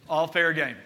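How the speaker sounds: ordinary talk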